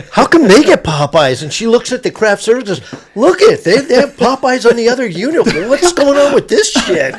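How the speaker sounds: high, loud, laughing indignation; retold outraged producer, played loud; mock indignant complaint. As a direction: demand answers from craft service, half laughing. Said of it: "she was like".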